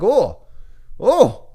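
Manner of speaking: a sudden warning voice that leaps up; alarm bells going off. Retold or imitated. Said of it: "It's like".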